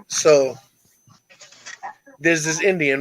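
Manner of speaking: ordinary talk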